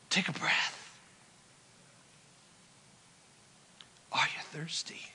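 unvoiced hush after the shout; whisper. Real, not acted. Preacher whispering to the congregation after shouting. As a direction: lean in and whisper.

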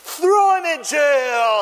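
high, loud, drawn-out sentence; villainous shout. Acted. Unmerciful servant condemns his debtor, hammed-up shout. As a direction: bellow it slowly like a stage villain.

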